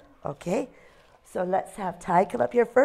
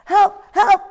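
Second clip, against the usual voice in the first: much higher, louder panicked cry; owl yelling for help; 12 semitones higher, 9 dB louder than usual. Acted. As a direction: yell Help! like a little owl faking alarm. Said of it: "he yelled".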